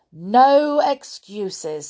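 loud, high, drawn-out rebuke; stern refusal. A narrator's reading. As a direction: shut the pupil down firmly. Grimm cuts off Winks.

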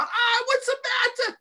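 high, loud worried grandmother call. Retold or imitated. Grandmother coming downstairs, high alarmed call. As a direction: rush in alarmed, calling high. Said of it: "she was Puerto Rican".

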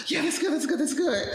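high, laughing imitation of the guys cheering it on; hammed-up eager yes-men; mock eager approval. Mimicking the writers at the table cheering the slap scene, high and laughing.

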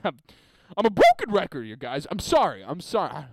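ordinary talk

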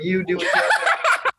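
slow, high, laughing surrender; embarrassed laughing. Retold or imitated. Embarrassed patient to nurse checking groin area, laughing. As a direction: give in helplessly through a high laugh.

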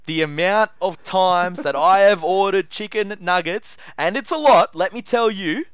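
loud exasperated rant. Real, not acted. Ranting about missing sauce.

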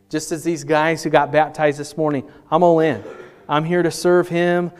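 loud, rising, earnest preaching; altar call preaching. Acted. Pastor voicing the newly baptized, loud and rising. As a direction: lift the room toward commitment.

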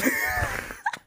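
high nasal snort of laughter; snorting laughs. Real, not acted. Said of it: "Fue risa nasal".

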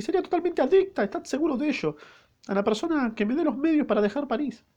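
overjoyed falsetto maid promising devotion; eager maid voice. Acted. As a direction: gush your gratitude up high. Maid thanks them eagerly.

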